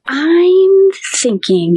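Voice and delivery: high, drawn-out musing; lightly voiced doctor thinking aloud; mock pensive doctor. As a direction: stretch it out like he's still working it out. Doctor pausing over the x-ray.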